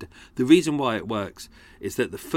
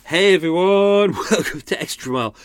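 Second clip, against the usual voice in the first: loud greeting; 7 semitones higher, 7 dB louder than usual. Real, not acted. Calls out the show's greeting.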